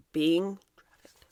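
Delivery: slow, hushed near-whisper; grave whisper. Real, not acted. Commentator telling of the actress's cause, grave slow whisper. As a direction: drop to a whisper on the unspeakable. Grave disclosure about children.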